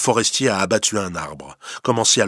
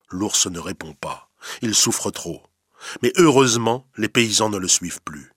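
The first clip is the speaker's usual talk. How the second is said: ordinary delivery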